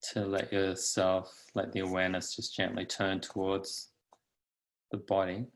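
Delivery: calm, unhurried guidance with long pauses; guided meditation. Acted. Teacher settling meditators into the body, calm guided meditation. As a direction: lead them gently into stillness.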